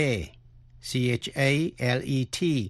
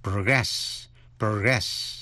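"progress" as a verb, pro-GRESS; teacher's model of the verb "progress", weight on GRESS; verb pronunciation; 3 semitones lower, much slower than usual. Shown as a demonstration. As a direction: stress the second syllable of "progress". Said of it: "Động từ của nó là".

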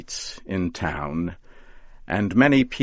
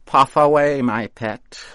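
high, louder delighted coaxing; happy witch; 7 semitones higher, 8 dB louder than usual. A narrator's reading. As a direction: cheer him on with glee. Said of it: "Mother Rigby said with happiness".

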